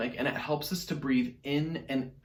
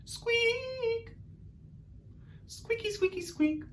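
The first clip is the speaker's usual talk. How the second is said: very high, tiny squeaks; Artie squeaks; 8 dB quieter, much slower than usual. Shown as a demonstration. Teacher leading the class in Artie squeaks. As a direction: squeak like a little mouse. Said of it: "our best Artie squeaks".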